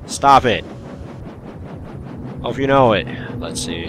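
ordinary delivery

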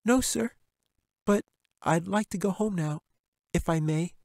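put-on high polite little girl; a small girl asking to go home. Katy answers the bear.

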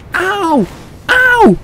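two high-pitched yelps of ow; rising gamer yelps; yelped ow. Real, not acted. Hit in the game.

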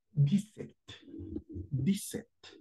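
"dix-sept" said softly as diset; French dix-sept. Shown as a demonstration. Teacher modelling dix-sept. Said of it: "La pronunciación".

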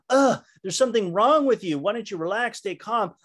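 fed up with an anxious friend; exasperated scolding. Retold or imitated. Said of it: "Instead of saying".

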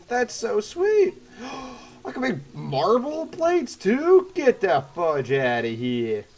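slow, high, drawn-out astonishment; over-the-top amazement. Real, not acted. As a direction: stretch the words out in awe. Exaggerated amazement at crafting.

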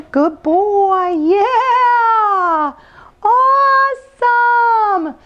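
high, drawn-out happy praise; excited dog praise. Real, not acted. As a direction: gush over the dog. Praising dog after hop.

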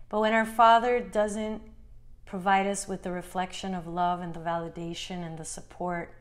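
normal talking voice

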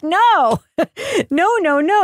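high-pitched, exasperated string of refusals; mock exasperated protest. Host objecting to coworker's word, mock exasperation. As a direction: object in amused disbelief.

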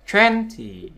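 "twenty" with a high, stressed first syllable; teacher's clear "twenty", front-stressed. Shown as a demonstration. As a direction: hit "twen" in "twenty", let -ty fall. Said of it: "Nhưng ngược lại này".

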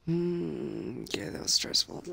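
hushed stealth whisper. Real, not acted. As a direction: keep it under your breath. Sneaking in stealth section.

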